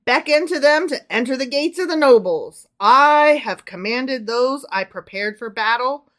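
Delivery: big swells on key words; dramatic scripture reading. Acted. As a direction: declaim it like a commanding prophet. Reads scripture aloud to co-host.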